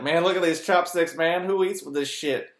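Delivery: hammed-up ignorant tourist; loud mocking parody of a clueless diner. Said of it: "they're like".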